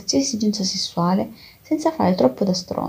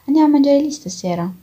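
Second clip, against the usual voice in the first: eager; 8 semitones higher, 5 dB louder than usual. A narrator's reading. Eager invitation at the bar.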